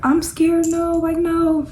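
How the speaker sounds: put-on childlike high frightened no; scared whimper. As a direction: replay your own scared pleading. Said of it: "why am I talking like that?".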